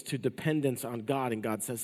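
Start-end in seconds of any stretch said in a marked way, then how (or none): none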